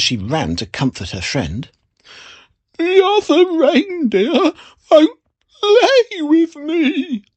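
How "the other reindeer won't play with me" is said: high tearful little reindeer voice; sobbing. A narrator's reading. Reindeer sobbing to Ethel about the others. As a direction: sob it out, lonely and hurt. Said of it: "sobbed Lawrence".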